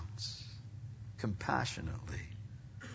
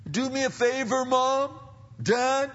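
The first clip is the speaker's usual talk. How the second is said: put-on high, loud kid calling to parents; mock demanding child; 14 semitones higher, 6 dB louder than usual. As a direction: imitate a child pestering Mom and Dad. Acted child demanding a favour.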